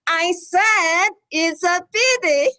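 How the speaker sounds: loud, slow, high mock exasperation. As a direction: pretend to lose patience, drawn out. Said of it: "I was joking when I raised my voice".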